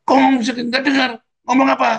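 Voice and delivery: high, loud irritated snap; snapping at the kids. Retold or imitated. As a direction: bark it at the whispering children. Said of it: "Terus aku jawab".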